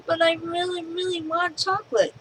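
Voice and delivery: put-on high, whiny little boy begging; pleading kid. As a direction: beg like a stubborn kid. Kid pleads a third time.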